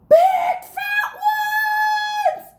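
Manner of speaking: very high, loud, slow scream; little boy screaming. A narrator's reading. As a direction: shriek in panic like a small boy. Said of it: "screamed a little boy".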